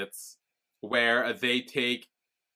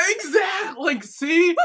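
much higher, near-tearful laughing squeal; squealing agreement; 6 dB louder, slower than usual. Real, not acted. Agreeing with her co-host about the ring, a giddy squeal. Gleeful agreement after the joke.

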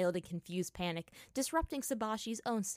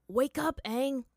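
urgent wake-up call; 6 semitones higher, 6 dB louder, slower than usual. Acted. Shaking a sleeper awake.

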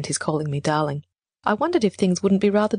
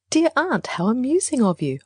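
higher, smoothly sweet; mildly smooth politeness, pitched up; 7 semitones higher than usual. A narrator's reading. Niece to her maddening aunt, smooth. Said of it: "I said smoothly".

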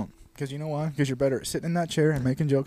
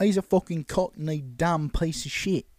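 higher, slowing mock cockney; mock cockney accent; 3 semitones higher, slower than usual. Joining a friend's riff on British dudes, mock cockney accent. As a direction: slag him off in a put-on cockney accent. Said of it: "the cockney British accent".

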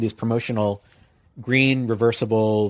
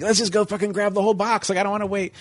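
eager bandmates; 8 semitones higher than usual. Retold or imitated. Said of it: "They were like".

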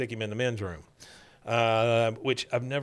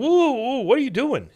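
higher, louder "whoa, whoa"; hammed-up alarmed manager; mock alarmed shout; 12 semitones higher, 5 dB louder than usual. Manager stopping a greeter seating guests, mock alarm. Manager stops Cotton, retold.

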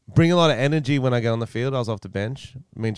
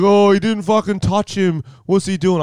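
slow, scornful, higher complaint; mocking defenders; 9 semitones higher, 6 dB louder than usual. Defenders scoffing at him for diving, slow scornful whine. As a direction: sneer at the diver. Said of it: "They're like".

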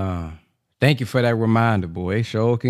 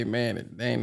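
ordinary delivery

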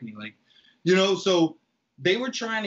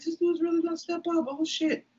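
much higher, startled realisation; alarmed disbelief; 11 semitones higher than usual. Retold or imitated. Recalling Frank coming up the stairs, startled alarm. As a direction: react as the threat sinks in.

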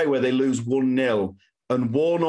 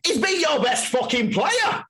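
mock-screamed touchline rant, much higher; imitating a scream. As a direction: shriek it like a furious manager at the ref. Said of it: "Warnock screams".

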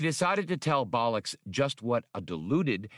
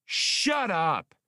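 much higher, louder snap; shout; 10 semitones higher, 4 dB louder than usual. A narrator's reading. Han losing his temper at the droid, shouting higher and louder. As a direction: blow your temper. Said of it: "he shouted at the droid".

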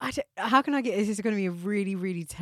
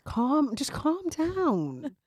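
very slow, higher, drawn out calm down; 6 semitones higher, much slower than usual. Real, not acted. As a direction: stretch it out to soothe her, teasing. Teasing friend to calm down.

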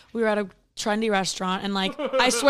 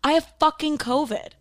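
higher, louder frustrated outburst; exasperated yell; 7 semitones higher, 3 dB louder than usual. Retold or imitated. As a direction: blurt it out at him. Yelling news at dad.

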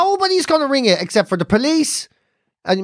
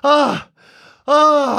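higher, puffing groans; mock breathlessness; 5 semitones higher than usual. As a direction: imitate mum wheezing down the phone. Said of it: "she rings me like this".

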